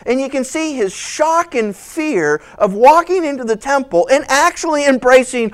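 ordinary delivery

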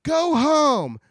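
high loud order; commanding shout. Acted. Gnome King ordering his gnomes home, loud and high. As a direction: call it out to the army. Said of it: "He's like".

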